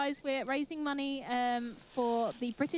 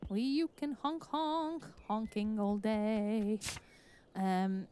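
usual speaking voice

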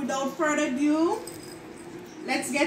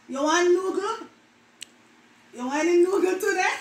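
higher, offering in patois; Jamaican patois; 4 semitones higher than usual. Shown as a demonstration. Vlogger offering noodles in patois. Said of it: "words that Jamaican mispronounce".

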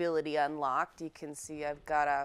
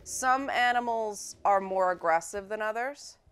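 usual speaking voice

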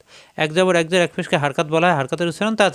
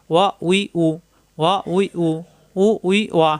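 ওয়া, উই, উ run together briskly; short syllables in quick groups of three; quick pronunciation. Shown as a demonstration. Teacher telling students to go fast, quick joined syllables. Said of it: "দ্রুত উচ্চারণ করতে হবে".

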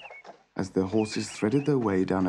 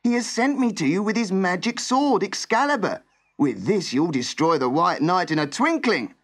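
high-pitched urgent servant's cry; raised, pressing voice; 7 semitones higher, 4 dB louder than usual. A narrator's reading. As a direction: press the message on him urgently. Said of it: "cried the servant urgently".

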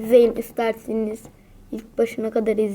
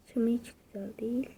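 fast, voiceless whisper of Hiç ama hiç güzel değil; 2 semitones lower, 13 dB quieter, much faster than usual. Shown as a demonstration. Gamer muttering the complaint again to himself, fast whisper. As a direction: rush it out as a breathy whisper. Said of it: "repeat of the line just said".

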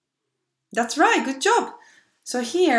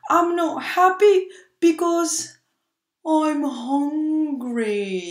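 put-on sad child; 7 semitones higher, slower than usual. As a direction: play Max the sulky kid. Max says he is hungry.

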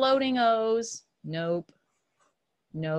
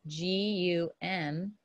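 slow, softer, letter by letter; spelling out; 4 semitones lower, 6 dB quieter, slower than usual. Shown as a demonstration. Teacher spelling gum for students, slow spelling. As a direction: spell it slowly, lower in pitch.

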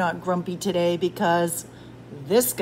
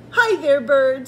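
loud, higher hello; cheery greeting; 6 semitones higher, 7 dB louder than usual. A narrator's reading. Rabbit hails Bird walking past, cheery. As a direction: call out a lively hello.

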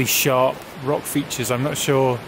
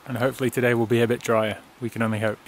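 normal talking voice

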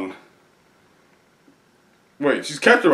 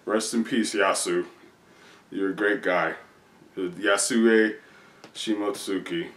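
quiet mourning; 4 semitones lower, 8 dB quieter than usual. Pretend. Eulogy for a dead character.

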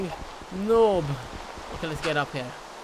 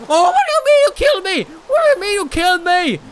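fast, much higher, louder protest; outraged disbelief; 8 semitones higher, 6 dB louder, faster than usual. Real, not acted. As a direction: blurt it out in shocked disbelief. Just got killed in game.

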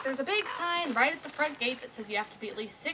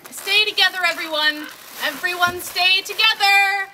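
loud, higher call to a group; 5 semitones higher, 13 dB louder than usual. Acted. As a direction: shout an order across the beach. Babysitter calling to swimmers.

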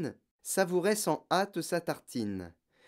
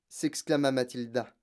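usual speaking voice

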